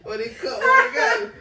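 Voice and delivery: high, slow, loud laughing yelp; hammed-up reenactment cry. Host reenacts the dog scene from I Am Legend with a high laughing yelp. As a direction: play the movie scene way over the top.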